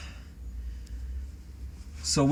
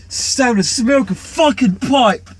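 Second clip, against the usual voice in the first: exaggerated Australian yell, much higher and louder; shouted Australian accent; 12 semitones higher, 10 dB louder, much slower than usual. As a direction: bellow it high and slow in a hammed-up Australian accent. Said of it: "That kind of thing".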